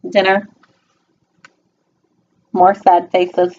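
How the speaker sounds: plain reading voice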